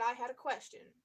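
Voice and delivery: ordinary talk, nothing marked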